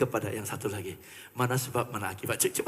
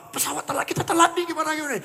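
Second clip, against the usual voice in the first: mock panic; 13 semitones higher, much faster than usual. Retelling old panic at airport.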